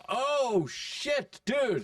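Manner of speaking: high-pitched "oh no" realization; retold dawning realization. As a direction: act out suddenly remembering, pitch jumping up.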